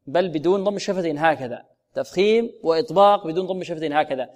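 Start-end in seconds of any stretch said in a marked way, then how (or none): none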